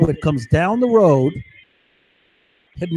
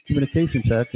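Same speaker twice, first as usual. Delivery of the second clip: ordinary delivery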